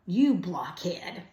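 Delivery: drawn-out, understated scolding yell; mild yell. A narrator's reading. Lucy scolds her brother, mildly yelling. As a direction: stretch out a mild storybook scolding. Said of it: "Lucy yells at her brother".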